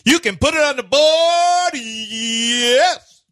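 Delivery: high, loud booming home-run call; John Sterling. Retold or imitated. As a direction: belt it out like a radio baseball announcer. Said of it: "Who you, John Sterling now?".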